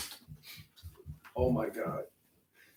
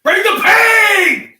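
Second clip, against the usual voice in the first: battle cry; 11 dB louder, much slower than usual. Real, not acted. Bracing for wax strip pull.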